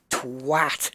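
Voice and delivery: "Twat" with a crisp a as in "cat"; English "Twat", said on its own as a model; demonstrated short A. Shown as a demonstration. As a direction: hit the short a in "Twat", rhyming with "hat". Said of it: "It's A".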